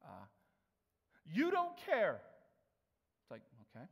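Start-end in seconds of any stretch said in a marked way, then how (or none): 1.3-2.2 loud, much higher outburst; angry shout. Retold or imitated. Furious customer yelling at the new cashier, loud and high. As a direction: yell it like an enraged customer. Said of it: "here was his response".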